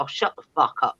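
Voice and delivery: usual speaking voice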